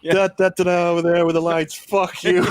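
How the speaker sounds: high, loud furious rant. Retold or imitated. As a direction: rant like a furious actor on set.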